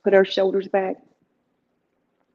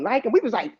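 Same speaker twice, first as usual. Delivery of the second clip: usual speaking voice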